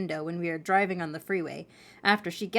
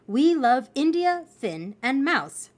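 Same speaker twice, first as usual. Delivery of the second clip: slow, raised reading of a message; proclaiming a sign; 5 semitones higher, 4 dB louder, slower than usual. Acted. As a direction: spell out each name grandly. Reads message painted on truck.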